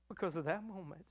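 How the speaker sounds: ordinary delivery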